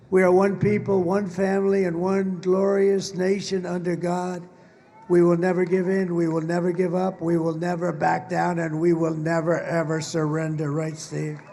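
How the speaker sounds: rousing rally speech, building to a roar. Acted. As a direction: work the crowd, big and proud.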